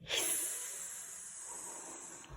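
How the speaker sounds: unvoiced hissing "Hiss"; snake hiss. Shown as a demonstration. Teacher modelling a snake hiss for children.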